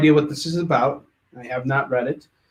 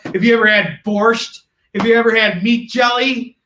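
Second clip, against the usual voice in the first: raised, higher-pitched comic rant; mock-heated retort; 6 semitones higher, 4 dB louder than usual. Streamer argues Russian food with Gio at dinner, mock-heated. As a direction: build to a high, hammed-up boast.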